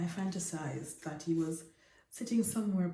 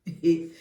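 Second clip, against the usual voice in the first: a brief amused laugh; short laugh; 9 dB louder than usual. Acted. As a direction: laugh off the joke lightly. He laughs at her joke.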